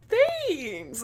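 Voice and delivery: high, uncertain little squeak; retold flustered, high-pitched reply; retold awkward thanks. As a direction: lift it high with a questioning rise. Awkward reply to odd compliment.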